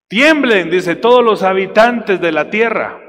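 slow, loud scripture proclamation; preaching at full force. Acted. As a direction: thunder the verse to the church. (predicación lenta y fuerte)

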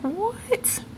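high, soft disbelieving "what"; baffled little question; incredulous what. Real, not acted. Disbelieving reaction again.

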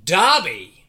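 slow drawn-out announcer build to a loud name; game show reveal. Pretend. Game show host reveal to the panel. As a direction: hosts announce the survey winner. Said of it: "Survey says".

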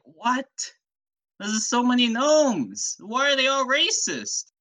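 high, hammed-up bewildered outcry; exaggerated high-pitched shock; mock disbelief. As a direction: exclaim it high, appalled by what you face. Detective's acted shock at attacking gnomes.

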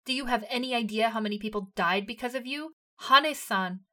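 loud, raging yell; furious shouting. A narrator's reading. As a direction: scream it at her. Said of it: "Eren shouted".

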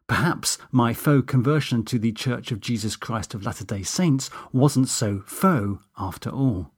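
ordinary delivery, nothing marked

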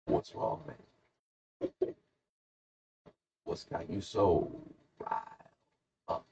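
soft, halting question with long pauses; hushed, hesitant mock concern; acted gentle query. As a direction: feel it out quietly, word by word. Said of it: "So I go".

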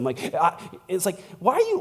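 usual speaking voice

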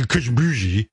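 mild brassy DJ greeting; a light nod to the DJ voice; Cousin Brucey impression.

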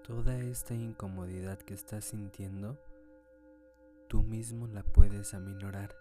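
calm, unhurried meditation guide; guided meditation. Acted. Leads a meditation. (voz de meditación guiada)

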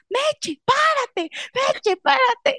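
very high, slowing laughing call; mock aunt calling. Aunt at the top calling to the mother, very high and laughing. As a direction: call out while cracking up.

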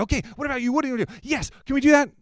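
high, eager questioning voice; eager yes man. Retold or imitated. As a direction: pester cheerfully like an overexcited helper. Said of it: "He's like".

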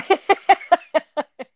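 bright loud laugh. Real, not acted.

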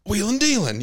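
hype. Retold or imitated. Mimicking wrestling promo hype.

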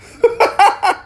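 high-pitched burst of laughter. Real, not acted. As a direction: cackle at the absurdity. Laughs at the snake story.

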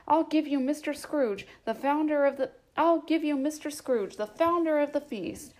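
cheerful toast. A narrator's reading. Bob toasts Scrooge.

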